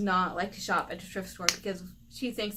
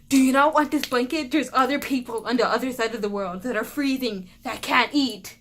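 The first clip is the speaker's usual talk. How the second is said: loud, raised-pitch mom lecture; yelling mother; 3 semitones higher, 9 dB louder than usual. Retold or imitated. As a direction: imitate a mom yelling a guilt trip. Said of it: "hears my mother yelling".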